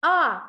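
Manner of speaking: А said loud and clear on its own; teacher's loud demonstration of А; model sound. Shown as a demonstration. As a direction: punch out an isolated, open А. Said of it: "разом вимовимо звук".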